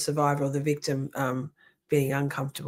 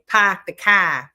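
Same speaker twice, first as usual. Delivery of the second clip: slow, louder Australian accent demo; 6 dB louder, slower than usual. Shown as a demonstration. Showing listeners how Australians say things, Australian accent. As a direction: draw it out in a closed-mouth Australian accent. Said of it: "Our mouths generally stay quite closed".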